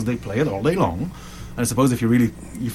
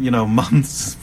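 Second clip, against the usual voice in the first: ordinary delivery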